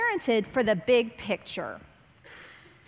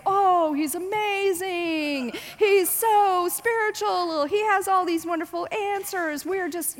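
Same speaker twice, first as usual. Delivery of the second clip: high, slow gushing praise; high, slow starry-eyed praise from the elders; admiring teachers; 7 semitones higher, slower than usual. Retold or imitated. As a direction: gush over him in awe. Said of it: "all of these great men are saying".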